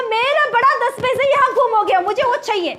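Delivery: lightly voiced stubborn little boy; child's voice. Child insisting on his coin. (ज़िद्दी बच्चे की हल्की आवाज़)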